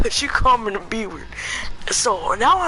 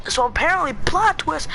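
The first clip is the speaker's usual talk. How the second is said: ordinary talk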